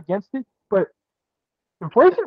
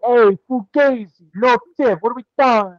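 loud, higher, hammed-up Jersey Italian; mock Jersey Italian wiseguy accent; New Jersey Italian accent; 5 semitones higher, 4 dB louder than usual. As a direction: play a pushy New Jersey Italian waiter. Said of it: "sound like he was from New Jersey there".

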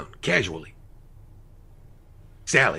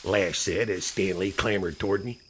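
usual speaking voice

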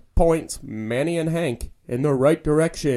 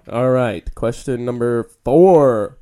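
slow quiz host announcing; 10 dB louder, slower than usual. Pretend.

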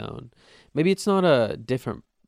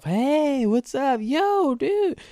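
much higher, awkward put-on hello; nervous high greeting; 5 dB louder, much slower than usual. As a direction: squeak it out like you're on edge. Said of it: "you almost don't even sound like yourself".

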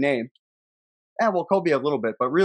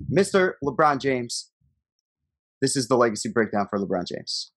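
announcer intro; 5 semitones lower than usual. Acted. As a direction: introduce the segment like a host. Show segment intro.

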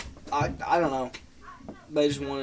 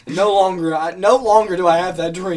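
loud, laughing thanks; laughing relief; 5 semitones higher, 12 dB louder, slower than usual. Real, not acted. Joking he no longer has that dream, loud laughing relief. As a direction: laugh it out, mock grateful.

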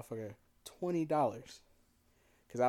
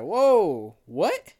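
high, loud startled whoa; shocked; 8 semitones higher, 12 dB louder than usual. Retold or imitated. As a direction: jolt up in disbelief. Shocked at "code red.

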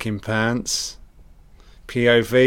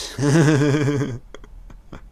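evil cackle; 4 semitones higher than usual. Pretend. Mocking the film's killer.

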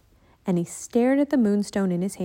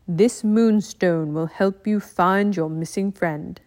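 calm ninja master; slower than usual. Acted. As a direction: speak like a wise old teacher.

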